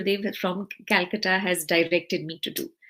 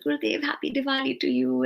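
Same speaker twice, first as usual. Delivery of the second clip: high, bright happy Diwali back; excited greeting; 6 semitones higher than usual. Retold or imitated. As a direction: gush it out, thrilled. Excited reply to guru's call.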